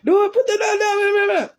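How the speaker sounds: high, loud, frightened squeal in imitation; mock panicked yell. Sister chased with a duck, mock panicked yelling. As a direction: imitate a scared sister shrieking as she runs.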